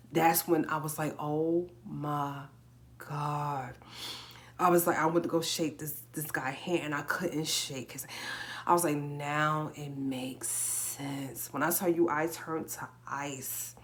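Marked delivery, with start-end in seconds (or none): 1.2-3.8 drawn-out, word-by-word disbelief; stunned realization. Retold or imitated. Retold moment of shocked realization. 9.0-11.5 very slow, heavily stretched words; dawning realization. Retold or imitated. Retold sudden realization, heavily drawn out.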